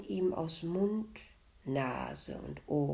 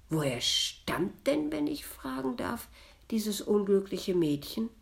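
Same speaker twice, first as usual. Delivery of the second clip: higher-pitched, politely probing question; feigned sympathy; 5 semitones higher, 4 dB louder than usual. A narrator's reading. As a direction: inquire with put-on concern. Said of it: "als hätte er Mitgefühl". (gespielt mitfühlend)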